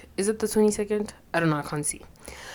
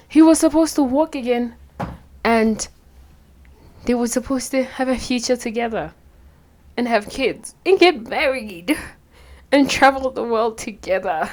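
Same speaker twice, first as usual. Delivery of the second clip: much higher and louder, voice crying; tearful whine; 7 semitones higher, 6 dB louder than usual. Real, not acted. Upset viewer complaining about the ending, tearful wail. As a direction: wail it out through tears.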